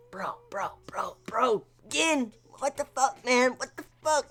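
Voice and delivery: soft whisper, stunned; whispered disbelief. Retold or imitated. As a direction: whisper it in horror. His own reaction to Gin.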